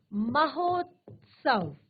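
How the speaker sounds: Mahotsav drawn out slowly; slow teacher's reveal of Mahotsav; slow drawn-out word. Shown as a demonstration. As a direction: stretch Mahotsav for the class. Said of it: "teacher reveals the joined word".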